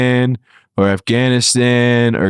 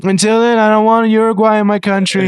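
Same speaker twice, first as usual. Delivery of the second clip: mock president voice; 7 semitones higher than usual. President impression continues.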